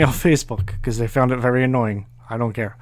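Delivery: usual speaking voice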